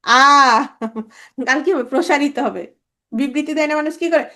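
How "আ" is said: আ held long with the mouth spread wide; wide open. Shown as a demonstration. Teacher demonstrating an open vowel, long wide আ. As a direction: open right up on a sustained আ. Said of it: "গাল একদম সরাই যাবে".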